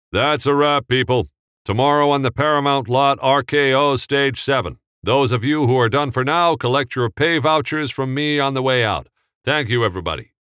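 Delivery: loud, high set announcement; wrap announcement. Acted. Assistant director announcing the wrap to everyone, loud. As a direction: address the whole crew.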